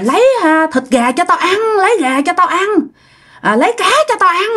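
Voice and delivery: high-pitched, insistent clamour for food; hollering demand. Acted. Old man demanding food from his bed, high-pitched hollering. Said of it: "Ông Bảy la làng lên nha".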